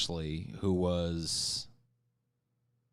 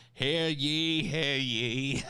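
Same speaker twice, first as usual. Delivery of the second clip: town crier; 6 semitones higher, much slower than usual. Pretend. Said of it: "No town criers".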